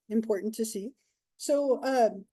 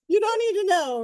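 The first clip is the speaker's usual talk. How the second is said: higher, louder teasing brush-off; mock-stern to the class, high and loud; mock firm; 8 semitones higher, 7 dB louder than usual. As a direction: playfully shut the question down, loud. Teasing class after daughter story.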